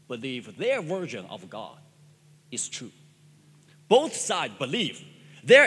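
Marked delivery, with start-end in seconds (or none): none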